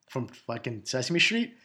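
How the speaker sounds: normal talking voice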